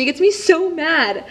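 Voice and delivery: high, loud, drawn-out annoyance; exasperated and mad. Real, not acted. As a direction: stretch it out in rising exasperation. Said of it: "how annoyed that makes me".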